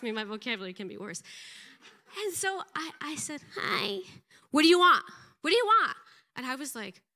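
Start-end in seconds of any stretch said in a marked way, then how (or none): 3.6-4.0 high-pitched, pathetic little greeting; mousy, pathetic. Retold or imitated. Awkward woman greeting an older stranger, mousy and pathetic. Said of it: "Like the most mousiest, pathetic hi". 4.5-6.0 loud, high, angry demand; pissed off. Retold or imitated. Older woman answering a stranger's greeting, pissed off.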